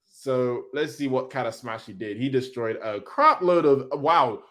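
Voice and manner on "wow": ordinary talk, nothing marked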